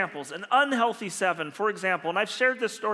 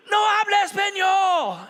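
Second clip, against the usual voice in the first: high, loud shout in clumsy Spanish; hammed-up yelled Spanish; mock shout; 11 semitones higher, 6 dB louder than usual. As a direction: shout it back, playing the clueless foreigner. Feigned ignorance shouted back at cop.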